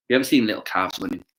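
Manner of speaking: ordinary delivery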